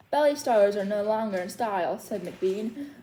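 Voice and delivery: lively singsong read-aloud of a rhyming book; story reading to children. Acted. Librarian reads Seuss rhymes to little story timers, lively singsong. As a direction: read the rhymes to the kids with bounce.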